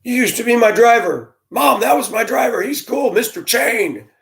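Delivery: put-on an excited kid spotting his old bus driver; imitating a kid. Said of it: "I'll run into a kid".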